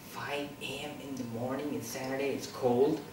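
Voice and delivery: low, hushed musing; private thought. Retold or imitated. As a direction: think aloud very softly. Retold sleepy early-morning thought.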